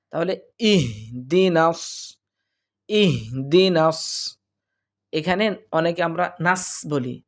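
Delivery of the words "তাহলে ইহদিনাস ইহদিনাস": ইহদিনাস drawn out slowly; slow Quran recitation. Shown as a demonstration. Teacher reciting ইহদিনাস slowly for students. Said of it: "উচ্চারণটা হবে".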